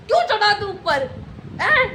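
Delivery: a hint of an angry mother; mock scolding mum. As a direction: play the scolding mother, half laughing. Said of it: "mummy ने उसको बोला".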